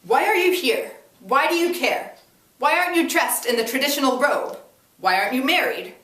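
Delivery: angry uncles firing accusations at the reporter; aggressive questions. A narrator's reading. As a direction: fire each question like a bullet. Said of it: "turning more aggressive, they shot back".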